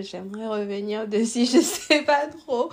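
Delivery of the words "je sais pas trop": slow, louder words with a laugh; nervous laugh. Real, not acted. Host laughing before a personal question to her guest. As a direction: laugh off the awkwardness.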